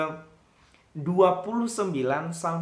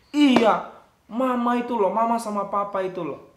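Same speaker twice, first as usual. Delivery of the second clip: put-on complaining child; 4 semitones higher, 6 dB louder than usual. Child complains about parents.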